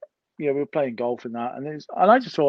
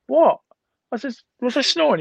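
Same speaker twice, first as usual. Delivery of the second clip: startled, higher "What?" and "Was I snoring?"; jolted awake by the accusation, higher; surprised; 10 semitones higher than usual. Retold or imitated. Told he snored, surprised and higher. Retelling his surprised reaction.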